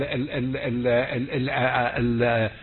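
ordinary delivery

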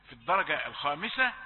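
ordinary talk

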